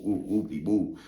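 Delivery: ordinary talk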